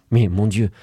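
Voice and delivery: ordinary delivery, nothing marked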